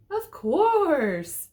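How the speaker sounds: high, loud, scared Cinderella; frightened stammer. A narrator's reading. Cinderella startled by the wolf, high and frightened. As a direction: squeak it out in fright. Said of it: "stammered a frightened Cinderella".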